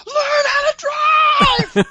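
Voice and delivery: slow, extremely high, furious scream; imitated full-force scream. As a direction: scream it as loud as you can, mimicking rage. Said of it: "screamed as loud as she could".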